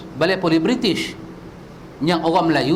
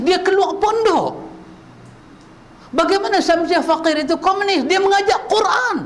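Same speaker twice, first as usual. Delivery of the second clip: much higher, near-shouted outrage; fiery preaching; 13 semitones higher than usual. Acted. Preacher defending Rashid Maidin and Man Indra, fiery near-shout. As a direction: thunder it out to the congregation.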